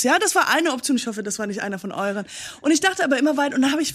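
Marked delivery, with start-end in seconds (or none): none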